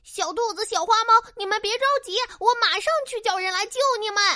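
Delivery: very fast, high urgent voice. Acted. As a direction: rush the words, no time to lose. Crow hurries to get help. (急促地安慰)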